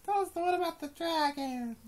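put-on high, loud child's pleading voice; mock child asking for a story; imitating a kid. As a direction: beg like an eager little kid at bedtime. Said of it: "they're gonna have favorites".

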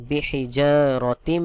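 slow বিহিজারাতিম with a long hummed final m; recited বিহিজারাতিম, held on the last m; merged pronunciation. Shown as a demonstration. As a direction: draw out জা, then sustain the closing m of বিহিজারাতিম. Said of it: "তখন উচ্চারণ হবে".

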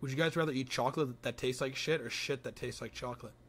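ordinary talk, nothing marked

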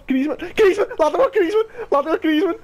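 screaming the name, high and loud; yelling in pure disbelief; shrieking jackpot winner. Real, not acted. Shouting at the pulled card.

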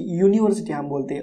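ordinary talk

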